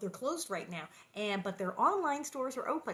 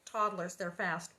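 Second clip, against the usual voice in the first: ordinary delivery, nothing marked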